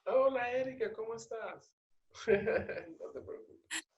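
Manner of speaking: high, sing-song hello; high-pitched cooing hello; cooing greeting. Real, not acted. Greets a crying little one on the call.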